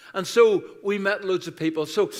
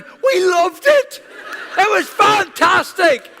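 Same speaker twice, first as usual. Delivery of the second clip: high, loud and slow, bursting with joy; laughing cheer of real enthusiasm; gushing delight; 10 dB louder, slower than usual. Real, not acted. Gushing about the cruise.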